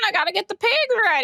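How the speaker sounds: high, hurried farmhand voice; hammed-up high farmer bit; mock farmer. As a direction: rush off to the morning chores.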